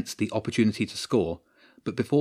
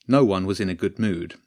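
usual speaking voice

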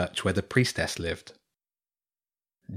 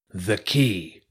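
commanding; much slower than usual. A narrator's reading. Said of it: "Gralf commanded".

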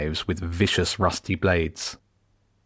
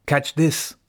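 a quick raised shout tossing something; shouted call; 8 semitones higher than usual. A narrator's reading. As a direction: call out high to a friend as you throw. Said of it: "Elf shouted".

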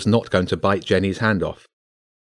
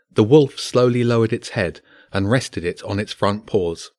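usual speaking voice

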